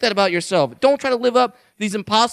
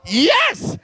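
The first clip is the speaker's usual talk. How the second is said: high outraged "Yes!"; exclaimed yes; 11 semitones higher than usual. Real, not acted. Comedian confirming an absurd law, high outraged exclamation. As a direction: shoot it up in disbelief.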